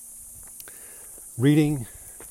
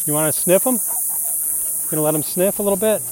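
higher, louder, cheery sing-out to a dog; friendly dog talk; 6 semitones higher, 9 dB louder than usual. Real, not acted. Walker letting a big doggy sniff, high and friendly. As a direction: greet a dog and coax it kindly. Greeting and soothing a passing dog.

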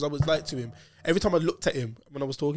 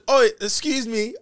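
mock politeness; 7 semitones higher, 3 dB louder, slower than usual. Mock polite to police officer.

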